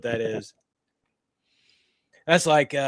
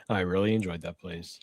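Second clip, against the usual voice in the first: lower, softer mock fondness; sarcastic; 5 semitones lower, 5 dB quieter than usual. Real, not acted. Repeating the line about the crazy house, sarcastic. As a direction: say it low and dry, meaning the opposite. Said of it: "That was sarcasm".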